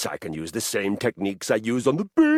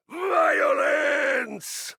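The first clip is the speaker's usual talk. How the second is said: much higher, roared war cry; high roaring shout of violence; battle cry. Acted. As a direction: bellow it like a Viking charging. Viking's answer to insult.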